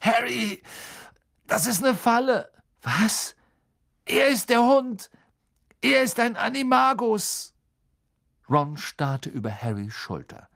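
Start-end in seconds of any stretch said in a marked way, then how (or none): 0.0-2.5 high, strained voice in pain; urgent through the pain; just broke a bone. Acted. Ron with broken leg warning. 2.8-3.4 surprised. Acted. Harry hears shocking warning. 4.0-7.5 high, strained voice in pain; urgent through the pain; just broke a bone. Acted. Ron with broken leg warning.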